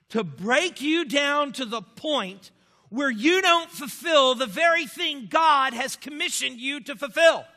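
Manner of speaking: loud, slow, fervent shout; preacher's shout. Retold or imitated. Doctor Pennington warning the student about Satan, shouting. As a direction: hammer it home as a preacher shouting.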